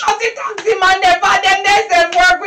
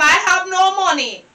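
ordinary talk

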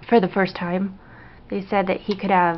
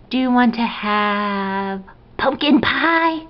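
slow build to a high, thrilled finish; 4 semitones higher, slower than usual. Real, not acted. Parent offering baby Thanksgiving dessert, excited baby talk. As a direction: draw out the question, then squeal the treat.

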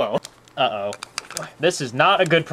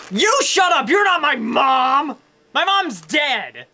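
angry yell; 6 semitones higher, slower than usual. Acted. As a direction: snap at whoever told you to calm down. Toy yells back at scolding.

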